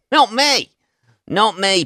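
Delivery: high, loud mock protest; comic denial. Answering own question about good old Glasgow, comic high-pitched denial. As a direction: squeak out a playful denial.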